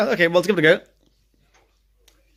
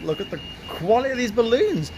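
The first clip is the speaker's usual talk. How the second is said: rising in wonder, pitch higher; amazed; 7 semitones higher than usual. Real, not acted. Vlogger marvelling at shop balloons, amazed. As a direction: gawp at the balloons in delight.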